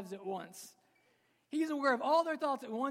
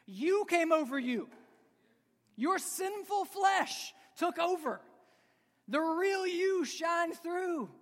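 forceful preaching; 7 semitones higher, 5 dB louder, slower than usual. Acted. Preacher quoting podcaster, rising force.

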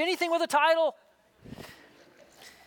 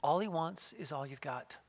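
soft whisper; 8 semitones lower, 10 dB quieter than usual. Retold or imitated. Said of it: "the Spirit just whispered".

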